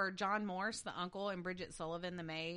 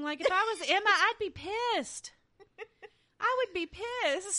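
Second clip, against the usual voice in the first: indignant; 11 semitones higher, 9 dB louder than usual. Real, not acted. Indignant outburst as Emma.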